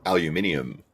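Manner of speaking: "aluminium" in five syllables, al-yoo-MIN-ee-um; British pronunciation. Shown as a demonstration. British guest showing Americans his word, "aluminium" as al-yoo-MIN-ee-um. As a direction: say "aluminium" with stress on MIN and an -ium ending. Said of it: "we say, um".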